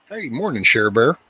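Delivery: ordinary talk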